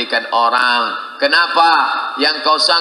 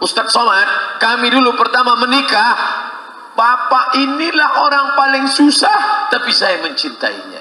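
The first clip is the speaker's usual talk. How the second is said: lady's voice; 11 semitones higher than usual. Retold or imitated. Wife telling Ustadz Somad her story, lightly higher. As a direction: speak as the proud wife.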